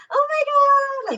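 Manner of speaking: squeaky, very high and drawn out; exaggerated high pretend voice; mock high pitch. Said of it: "why is her pitch so high".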